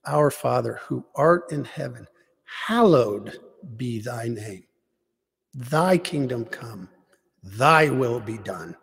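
slow Our Father, leaning hard on HALLOWED and THY; deliberate prayer, weight on "hallowed" and each "thy"; emphasized prayer. Shown as a demonstration. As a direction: pray it with conviction, drawing out "hallowed" and "thy". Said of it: "I emphasize the words that really mean something".

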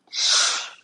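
a soft unvoiced hush; hushing shh. Shown as a demonstration. Preacher hushing her listeners, soft unvoiced shh. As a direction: shush gently as if quieting a room. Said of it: "wants to whisper to you".

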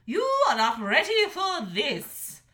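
high, loud stern order; commanding dragon mother. A narrator's reading. Mother dragon ordering her son home, high and loud. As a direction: order him home, no arguing.

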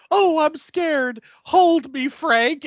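high, slow, hammed-up fake fright; a woman faking fear to flirt; mock damsel. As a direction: play it coy and overdone. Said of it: "she's like".

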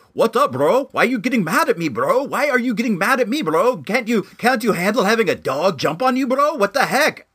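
high, bouncy mocking impression; bro-dude voice. Telling viewers about the dog owner, mocking bro voice. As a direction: imitate a whiny challenging bro, lilting up and down. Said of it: "he has, like, this voice like".

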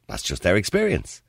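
usual speaking voice

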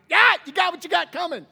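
loud, high-pitched mock jeer; gloating shout. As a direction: yell it like a taunting victor. Acting gloating Israelite watching enemies drown.

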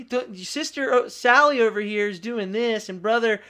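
hammed-up complaint; mock whiny deflection. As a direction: point at others in mock protest. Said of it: "to be like".

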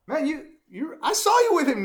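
lightly acted, high-pitched accusation; insistent accuser. Acted accusation of Peter in story.

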